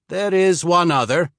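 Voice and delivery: put-on wary Swede sizing up a stranger, slight accent; slight Swedish accent. Swede asks the traveler who sent him, slight Swedish accent. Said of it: "He spoke with only a slight accent".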